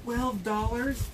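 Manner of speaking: slow, high disbelieving question; shocked. Real, not acted. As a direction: stretch it out in outrage. Shocked at the price.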